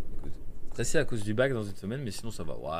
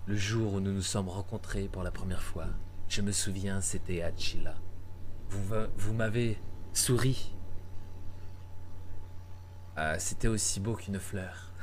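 romantic reading; 3 semitones lower than usual. Acted. Translating a romantic letter aloud.

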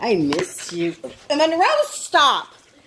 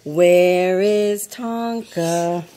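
slow drawn question; 7 semitones lower, much slower than usual. Real, not acted.

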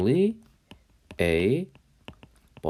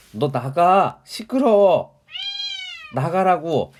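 shout; 10 semitones higher, 4 dB louder, slower than usual. Real, not acted. Teacher shouting at interrupting cat.

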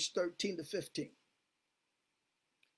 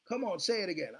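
usual speaking voice

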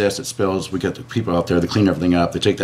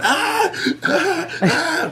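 mock agonized cries, much higher; exaggerated pain cries; much slower than usual. Imitating a coworker on acid with overdone pain cries. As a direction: ham up the pain like a tripping guy. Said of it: "really over-exaggerating the pain".

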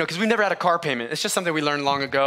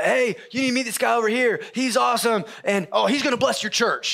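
playful gym friend; 6 semitones higher than usual. Retold or imitated. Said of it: "somebody's like".

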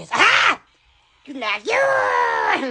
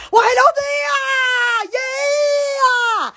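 hammed-up greeting; 8 semitones higher, much slower than usual. Greeting viewers with flourish.

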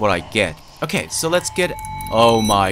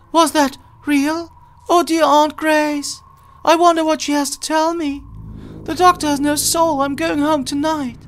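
put-on much higher girlish voice; cute high voice. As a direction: play the worried little girl. Voices game character.

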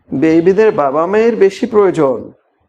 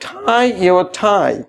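tie your tie stretched out very slowly; slow model phrase; much slower than usual. Shown as a demonstration. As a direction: draw out each word for learners. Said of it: "slow model phrase".

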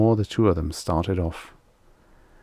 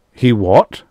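loud, higher, sharp question; demanding; 4 semitones higher, 9 dB louder than usual. A narrator's reading. Extroni interrupting Rye's accusation, demanding. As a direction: cut in loudly and insist on an answer. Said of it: "Extroni demanded".

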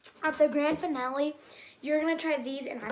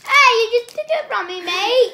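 loud; 8 semitones higher, 12 dB louder than usual. Real, not acted. Protesting grabbed candy.

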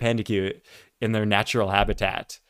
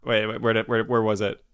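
ordinary delivery

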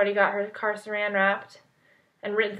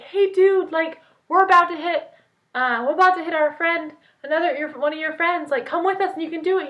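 high, eager, fast-talking pitch to a friend; hyped-up invite; 7 semitones higher, 3 dB louder than usual. Retold or imitated. Calling a friend to come join the prank, high and eager. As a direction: sell it like the best plan ever. Phone call luring their friend.

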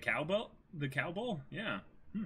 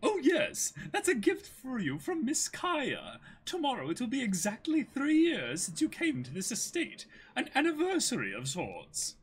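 much higher, gentle servant voice; polite butler; 7 semitones higher than usual. Acted. Merry to Kuro, explaining the glasses in a much higher voice. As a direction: present the gift warmly.

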